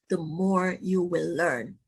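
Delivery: normal talking voice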